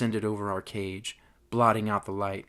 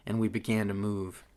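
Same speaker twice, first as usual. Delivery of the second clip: plain reading voice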